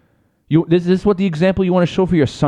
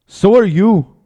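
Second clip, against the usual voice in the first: higher, louder mock Irish accent; Conor McGregor; 6 semitones higher, 5 dB louder, slower than usual. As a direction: answer back in a put-on Irish brogue. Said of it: "do his fucking Irish accent".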